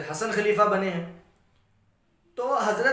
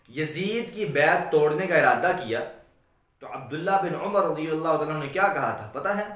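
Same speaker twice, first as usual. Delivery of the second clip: ordinary talk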